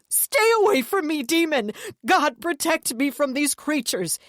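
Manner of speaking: high, loud voice trembling with fear; shaking, choked terror. A narrator's reading. Deluded brother crying out at the demons. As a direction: choke out the words in panic. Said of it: "he choked out, his voice shaking".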